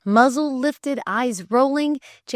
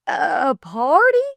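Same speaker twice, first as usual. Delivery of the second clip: stammered; 7 semitones higher, 6 dB louder, slower than usual. Acted. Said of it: "stammered the lay reader".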